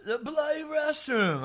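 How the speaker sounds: put-on loud, high, drawn-out English accent; hammed-up English accent outburst; exasperated English accent. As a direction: imitate a fed-up Englishman spelling it out. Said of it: "finally, he's like".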